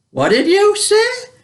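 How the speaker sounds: high, loud, drawn-out challenge; outraged mother. Retold or imitated. Mother pulls up daughter for slang, high outraged demand. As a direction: imitate a scandalised mum, pitch shooting up.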